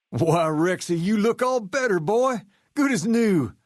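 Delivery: high, doting praise for a pet; fond high voice for a good boy; cooing to dog. Acted. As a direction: coo over the dog like a proud owner. Greeting his healed dog.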